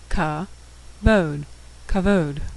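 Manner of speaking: "ka", "bod", then blended "kavod"; syllables first, then the whole word "kavod"; sounding out syllables. Shown as a demonstration. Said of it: "We've seen all the letters".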